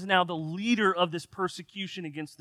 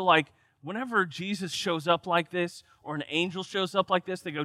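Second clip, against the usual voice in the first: usual speaking voice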